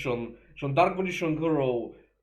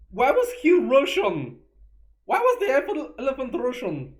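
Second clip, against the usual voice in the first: incredulous; 11 semitones higher, 7 dB louder than usual. Real, not acted. Incredulous outburst about Russian elephant.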